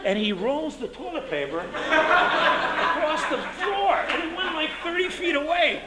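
high, laughing through the words; telling the toilet paper punchline while laughing hard. Real, not acted.

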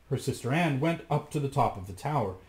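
ordinary delivery

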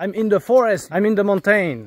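high, insistent plea over the phone; urgent repeating. Real, not acted. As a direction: make a confused listener understand where you are. Repeats location to confused phone listener.

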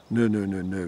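usual speaking voice

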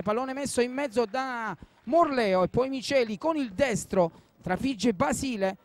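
normal talking voice

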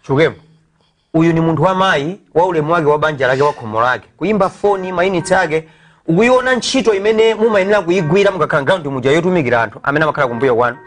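ordinary talk, nothing marked

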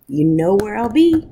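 ordinary delivery

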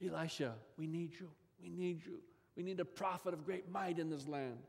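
quiet, pleading murmur; soft plea. Acted. The king begging Elisha to stay, soft pleading.

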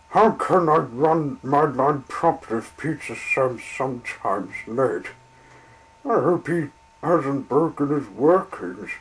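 fretting over a late train; upset little engine. Acted. Little engine fretting to himself about the late train, upset. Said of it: "was upset".

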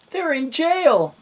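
very high, loud, stretched cry. A narrator's reading. Dots reaching the top, alarmed high cry. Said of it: "cried Dots".

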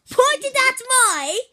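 loud, slow, high exasperated yell; drawn-out shout. Real, not acted. Turtle hollering at the cameraman a second time, drawn out. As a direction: stretch it out, fed up. Repeats correction, louder and slower.

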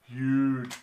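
a light take on a brash tycoon stretching huge; Donald Trump. Retold or imitated. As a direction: lean lazily into huge, like a boastful showman. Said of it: "getting all these things from Donald Trump".

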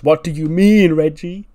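high, loud shout while shaking him; Manny shaking Reggie for an answer, shouting loud; shaken demand. Retold or imitated. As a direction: yell it as if grabbing and shaking someone. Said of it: "grabs him and shakes him and goes".

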